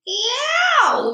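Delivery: very high, loud drawn-out roar; giant's roar. A narrator's reading. Giant caught in a nightmare, roaring. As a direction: howl it out at full voice. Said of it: "roared the flesh lump eater".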